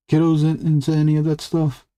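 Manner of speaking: kiddos lifted up high and bright. Real, not acted. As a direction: pitch the question up eagerly. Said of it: "never say kiddos like that".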